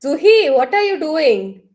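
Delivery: high, loud and demanding, slowed. Shown as a demonstration. Counselor demonstrating a harsh tone to a client, loud and sharp. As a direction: call her name sharply, like a scolding. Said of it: "I'll just give one very example".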